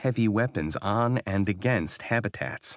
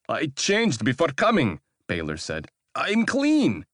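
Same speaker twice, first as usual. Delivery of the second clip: much higher and louder, panicky; unsure, nervous; 9 semitones higher, 5 dB louder than usual. Acted. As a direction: insist without believing it. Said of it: "His voice didn't sound so sure".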